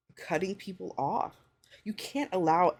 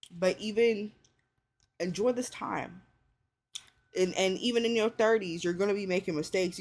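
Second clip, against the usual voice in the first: usual speaking voice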